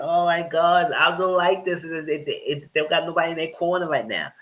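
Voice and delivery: high, worked-up dismay; worked-up reaction. Real, not acted. Host upset the pair have nobody, high alarmed dismay.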